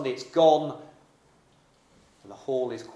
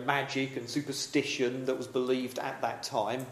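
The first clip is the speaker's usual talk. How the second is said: ordinary talk, nothing marked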